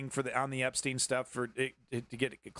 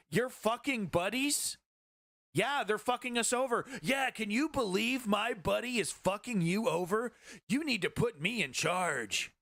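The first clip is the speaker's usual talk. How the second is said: higher mocking voice, slow and drawn out on yeah; sarcastic retort; 6 semitones higher than usual. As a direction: scoff at them, stretch each yeah. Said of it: "And you're like".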